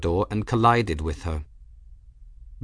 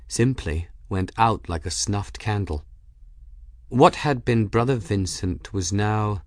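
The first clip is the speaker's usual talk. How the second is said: ordinary delivery, nothing marked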